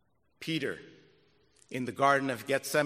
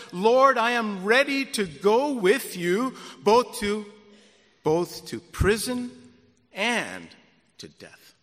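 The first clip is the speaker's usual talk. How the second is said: Peter's vow; 5 semitones higher, 6 dB louder than usual. Acted. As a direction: swear loyalty, then let it sink. Peter vows to the Lord.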